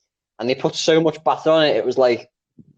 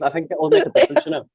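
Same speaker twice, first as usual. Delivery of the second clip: high, put-on pointed suggestion; retold suggestion; 14 semitones higher than usual. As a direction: offer it with mock helpfulness. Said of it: "and I was like".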